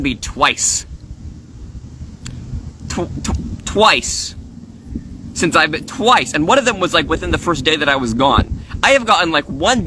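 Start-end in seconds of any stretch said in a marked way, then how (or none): 3.7-4.3 twice said higher with a falling punch; emphatic twice. Real, not acted. Complaining Lavender DMed only twice, stressed twice. Stressing how few DMs. 5.9-6.3 twice said higher with a falling punch; emphatic twice. Real, not acted. Complaining Lavender DMed only twice, stressed twice. Stressing how few DMs.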